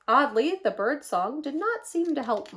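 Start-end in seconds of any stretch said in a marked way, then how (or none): none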